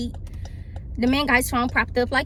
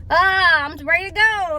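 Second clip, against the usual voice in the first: mock impatient whine; 4 semitones higher, 6 dB louder than usual. Tipsy passenger wanting to leave, hammed-up whine. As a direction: groan it out, hamming up impatience. Acts out impatient change of mind.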